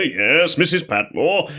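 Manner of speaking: imitated butler of a big man; Mister Carson. Shown as a demonstration. Butler answering Missus Patmore, put-on big-man voice. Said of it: "could sound like Mister Carson".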